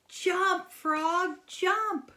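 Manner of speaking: high, loud cry for the frog to jump; urging shout. Pretend. Teacher reading to kids shouts at the frog to jump. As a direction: yell at the frog to get away.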